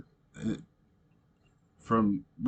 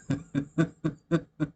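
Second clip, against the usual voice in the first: short amused laugh; a real laugh after the last line; 6 semitones higher than usual. Real, not acted. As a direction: laugh at the poem's ending. Laughs after finishing the poem.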